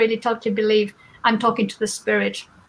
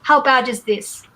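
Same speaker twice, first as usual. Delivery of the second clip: normal talking voice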